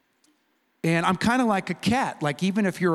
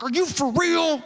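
high, worked-up mock challenge; jacked up; 12 semitones higher than usual. Jacked-up employee answering unexpected thanks, ready to fight. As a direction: imitate a guy ready to fight. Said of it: "he's all jacked up".

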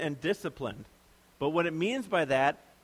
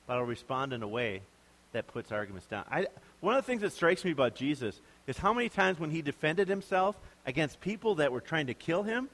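ordinary delivery

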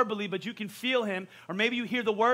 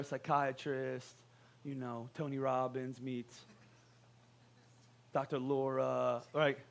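low aside; 8 semitones lower, 10 dB quieter, slower than usual. Real, not acted. Pastor listing job aliases.

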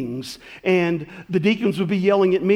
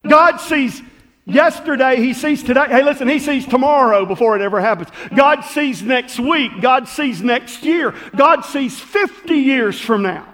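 loud, rising preacher's build; preaching; 6 semitones higher, 6 dB louder than usual. Acted. Preacher thundering to the congregation, loud and building. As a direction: preach it out with growing force.

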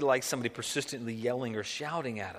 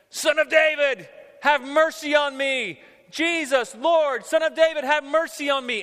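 high, loud pleading cry; yelling, crying out; 10 semitones higher, 8 dB louder than usual. Acted. Preacher as Canaanite woman calling to Jesus, loud acted cries. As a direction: shout for mercy over a crowd. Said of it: "she's yelling with a loud voice".

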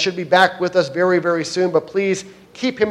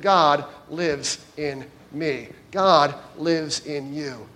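slow emphasis; 2 semitones lower, 4 dB quieter, much slower than usual. Shown as a demonstration. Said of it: "repeated truth, slowed".

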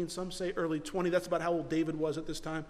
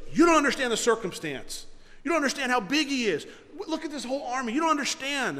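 mildly heated, higher-pitched objection; exasperated protest; 6 semitones higher than usual. Acted. Eliab warning David about the giant's army, exasperated. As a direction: argue back, pitch raised, pressing the danger.

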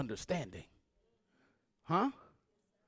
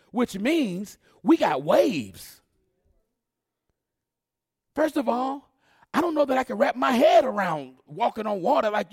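louder, higher preaching build; full-force preaching; 7 semitones higher, 8 dB louder than usual. Acted. Preacher building the storm story for congregation, full force. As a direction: whoop it up to the climax.

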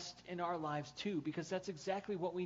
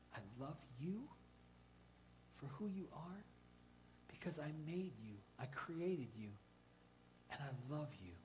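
God whispering; 7 dB quieter than usual. Acted. Preacher voicing God whispering to each listener, hushed. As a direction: murmur it gently to the heart. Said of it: "hear him whispering to your heart".

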